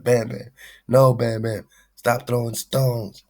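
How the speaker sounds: high, pleading call to stop; mock scolding. Talking to Bam Bam on screen, mock scolding high. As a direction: scold him like a worried friend.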